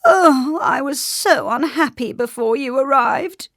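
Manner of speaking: high, loud lament; theatrical high-pitched misery; wailing. A narrator's reading. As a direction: wail like a wretched king. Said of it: "he wailed".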